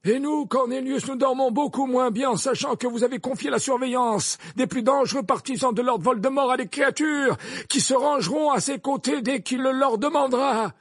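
high, loud, insistent defence; forceful headmaster. Acted.

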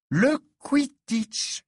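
high, drawn-out outrage; wrathful exclamation. A narrator's reading. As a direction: spit out the sport slowly, indignant. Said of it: "s'exclama Hermione courroucée".

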